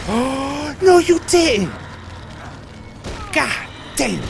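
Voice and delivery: gasping, high-pitched loud outcry; shocked scream. Real, not acted. Player reacting to a scare in the game, shocked scream. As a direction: yell it in real shock. Jump scare while playing.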